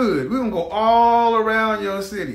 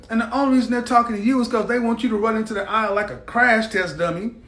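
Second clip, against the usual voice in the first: ordinary talk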